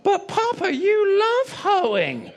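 put-on high, very slow little boy voice; drawn-out innocent toddler pipe-up; child's voice. Son piping out to Papa mid-sermon, high slow childlike voice.

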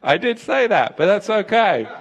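loud, high fiery preaching, almost shouting; full-force preaching. Real, not acted. Preacher rousing the congregation with a loud, high call. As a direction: preach it hard to the back row.

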